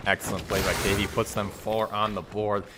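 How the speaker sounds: ordinary talk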